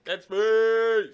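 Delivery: high loud hype shout; excited shout. Real, not acted. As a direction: yell the title with hype. Echoing the title.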